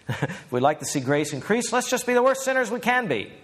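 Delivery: high mocking voice of a smug objector. Preacher mocking an imaginary opponent, high and sneering. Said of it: "arguing with an imaginary opponent".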